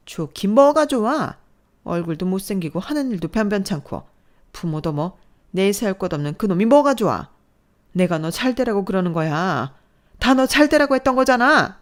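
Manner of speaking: mother shouting down her daughter's choice; angry shouting. Acted. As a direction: scoff and yell. Mother rejects boyfriend angrily.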